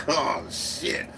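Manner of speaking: whispered oh-no; soft, voiceless aside of alarm; mock panic under the breath; retold dread. Retold dread at partner's defiance.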